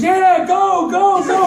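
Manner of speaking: loud, high cheering shout. Retold or imitated. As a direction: yell it like a girlfriend cheering from the sidelines. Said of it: "comes up and says".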